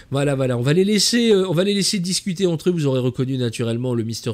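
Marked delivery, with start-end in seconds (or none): none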